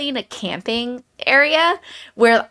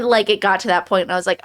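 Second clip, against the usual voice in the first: normal talking voice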